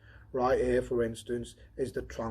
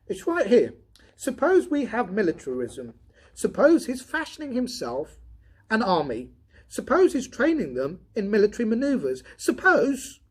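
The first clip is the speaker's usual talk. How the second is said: higher, louder voice for the redhead Donovan; Donovan voiced higher; 11 semitones higher, 11 dB louder than usual. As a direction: lift into the tired redhead's voice.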